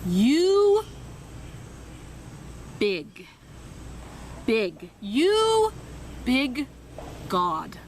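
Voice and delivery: groping for each Portuguese word; halting broken prayer. Retold or imitated. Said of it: "This is literally how it went".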